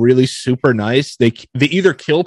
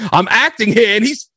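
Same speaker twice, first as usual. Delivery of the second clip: much higher mock complaint; mock rant; 10 semitones higher than usual. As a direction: whine like the offended star. Retelling Bale's rant.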